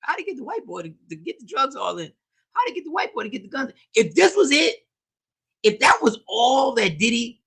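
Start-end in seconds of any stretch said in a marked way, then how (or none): 0.0-3.7 high, soft, rapid-fire mock questioning; mock onlookers. Host imitates people puzzling over the story, mock onlookers. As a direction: quietly rush it in a raised pitch. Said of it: "We'd be like".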